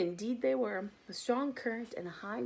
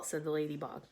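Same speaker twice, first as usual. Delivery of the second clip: plain reading voice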